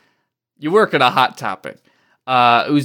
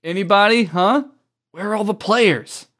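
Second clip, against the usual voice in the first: high, searching call; raised-pitch calling voice; 6 semitones higher than usual. Acted. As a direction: call out hopefully for anyone listening. Character calls out for other players.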